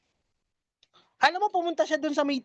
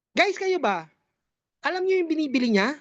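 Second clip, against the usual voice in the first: usual speaking voice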